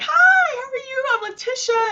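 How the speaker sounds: very high, sweet, over-friendly hello; sugary high introduction to a stranger; sing-song greeting. Retold or imitated. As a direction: greet him brightly, way up in pitch. Greeting husband at the door.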